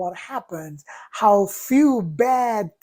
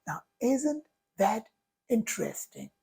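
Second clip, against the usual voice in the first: softer, falling into a near hush on "interesting"; gently reframe, quieter and quieter; calm curiosity; 8 dB quieter than usual. Shown as a demonstration. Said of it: "I say".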